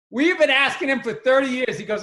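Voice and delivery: normal talking voice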